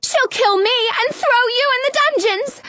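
very high, squealing dread; frantic high voice of a frightened girl; panicked shriek. A narrator's reading. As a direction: keep shrieking in high-pitched alarm. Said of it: "Snow shrieks".

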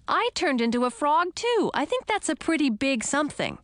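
high, indignant protest; exclaiming. A narrator's reading. Frog girl insisting something happened, exclaiming. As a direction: push the pitch up in outrage. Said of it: "I exclaimed".

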